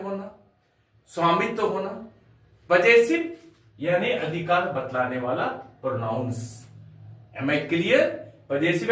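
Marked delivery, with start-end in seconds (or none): none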